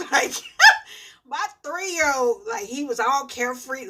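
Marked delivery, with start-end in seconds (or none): 0.1-1.2 squealing laugh, much higher and louder; real laughter breaking out; high burst of laughter. Real, not acted. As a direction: crack up at your own joke. Laughs at own story.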